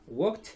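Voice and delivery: "Walked" said high and loud, one syllable ending in t; clear teaching demo of "Walked". Shown as a demonstration. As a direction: say "Walked" as a single syllable with a crisp final t. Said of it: "Vamos a ver cómo se pronuncia".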